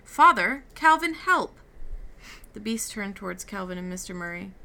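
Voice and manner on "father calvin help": high, very loud cry for help. A narrator's reading. Meg crying out to her father and Calvin for help. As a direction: scream for rescue. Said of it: "Meg cried".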